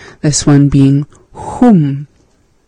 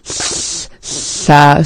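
slow careful sound. Shown as a demonstration. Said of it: "has that tha".

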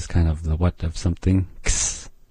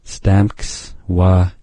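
Stamks wa kstuch said very slowly, syllable by syllable; teacher slowing right down; slow demo; much slower than usual. Shown as a demonstration. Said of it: "This kstuch can be a little tricky".

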